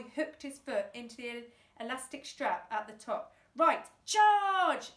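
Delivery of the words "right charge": high loud battle cry; charge order. A narrator's reading. Sergeant ordering his men to charge, loud cry. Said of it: "announced Sergeant Scoth".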